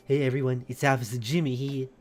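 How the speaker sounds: put-on low pre-transition voice with a city accent; Officer Jimmy. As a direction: do a goofy low cop voice. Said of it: "he had a funny voice".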